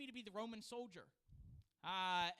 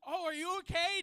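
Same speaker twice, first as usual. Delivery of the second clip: high, loud worried question; panicked concern; alarmed; 9 semitones higher, 12 dB louder than usual. Retold or imitated. Worried helper asking the fallen pastor, alarmed. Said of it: "I'm like".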